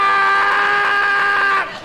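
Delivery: loud high cry; queen ant call. Pretend. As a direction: bellow it like a giant queen ant. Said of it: "the queen ant ... goes".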